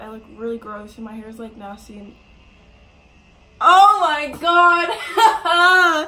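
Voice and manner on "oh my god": high, drawn-out real shout, breaking into laughter; shocked outburst. Real, not acted. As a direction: shriek it out, caught red-handed. Shocked that prank was found out.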